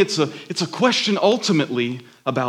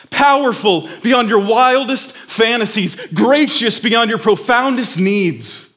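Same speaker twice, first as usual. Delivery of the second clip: higher, louder building proclamation; preaching crescendo; 6 semitones higher, 3 dB louder than usual. Acted. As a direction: build the praise like a rising sermon. Preaches at full force.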